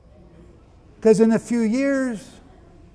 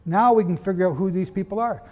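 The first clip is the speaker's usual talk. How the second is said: usual speaking voice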